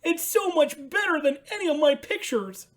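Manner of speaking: high, bright puppet voice for Ernie; excited high Ernie calling out. Acted. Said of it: "said Ernie".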